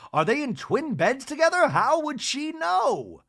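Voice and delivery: high, loud, drawn-out disbelief; incredulous. Retold or imitated. Bronwyn hearing the rumor, shrill with disbelief. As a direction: squeal the question in disbelief. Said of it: "She goes".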